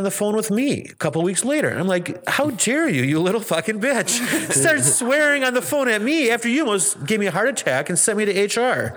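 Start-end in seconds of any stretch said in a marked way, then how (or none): 2.3-9.0 hammed-up indignant rant, much higher, laughing through it; mock outrage. Speaker mock-rants at coworker who swore on the phone, laughing. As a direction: play-act fury, half laughing.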